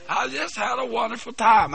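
shouted out with joy. Real, not acted. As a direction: belt it to the congregation. Preacher repeats it, shouting.

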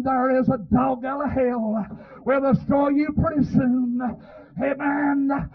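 normal talking voice

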